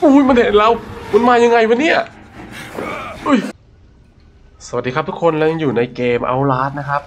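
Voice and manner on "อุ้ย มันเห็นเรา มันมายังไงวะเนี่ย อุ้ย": sudden high panicked yelp; startled fright. Real, not acted. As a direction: jump in fright, loud and high. Spotted by enemy.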